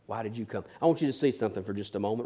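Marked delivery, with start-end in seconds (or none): 0.1-0.6 much softer, nearly breathed; hushed repeat. Acted. Jesus repeating to Judas, hushed. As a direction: drop to a near whisper.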